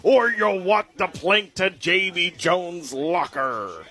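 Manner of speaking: slow, full pirate growl; really commit to the pirate. Acted. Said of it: "let me get this to you authentically".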